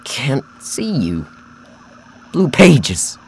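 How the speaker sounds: slow, then high and loud; crazed man. Acted. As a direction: drag out the words, then cry out. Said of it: "He spoke erratically with a crazed smile".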